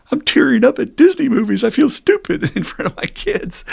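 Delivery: high, loud mock-distressed voice; imitated embarrassed newcomer. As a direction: act out a guy embarrassed by his tears. Acting out tearful newcomer's complaint.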